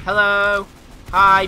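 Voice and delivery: loud cheery taunting hello; sarcastic hello to enemies; mock-friendly greeting. Real, not acted.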